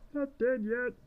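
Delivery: very high mock protest; high-pitched, hammed-up witch cry; witch calling out. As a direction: pipe up high from inside the oven. Witch calls out from the oven.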